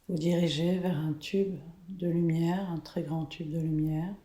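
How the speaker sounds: slow, soft meditation voice; guided meditation. Acted. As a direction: guide them into calm, slowly. Leads a guided color meditation.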